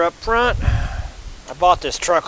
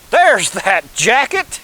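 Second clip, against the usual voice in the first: excited; 5 semitones higher, 6 dB louder, much slower than usual. Real, not acted. Finding lost jacket.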